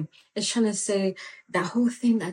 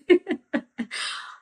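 sudden high giggle; high burst of laughter; 12 semitones higher, 3 dB louder than usual. Real, not acted. Preacher laughing at teasing viewers, high laugh. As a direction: laugh out loud at your own line.

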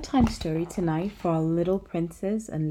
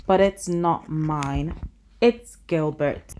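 put-on slow, loud smug child; drawn out like a proud secret; slow smug reveal; 3 dB louder, slower than usual. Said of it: "said the little princess".

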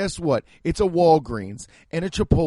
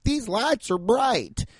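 mock small-town awe; 7 semitones higher, slower than usual. Small-town visitor gawking at the city, mock awe. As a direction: gawk at the lights like a hayseed. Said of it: "me coming from a small town being like".

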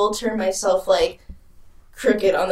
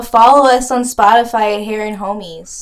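louder, projected podcast plug; calling out a plug; 8 dB louder than usual. Pretend. Podcaster playfully plugging the show to overhearing neighbours, calling out. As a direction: pitch it to the eavesdroppers next door.

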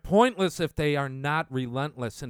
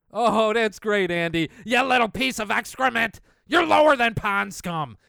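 louder, higher, biting sarcasm; sarcastic mock praise; 5 semitones higher, 5 dB louder than usual. Mocking the quoted man with louder sneering sarcasm. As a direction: fake cheer turning to scorn.

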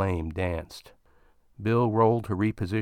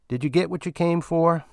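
higher miner voice, teasing; sly question; 8 semitones higher, 3 dB louder than usual. Acted. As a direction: ask with a knowing nudge. Walt asking Bill.

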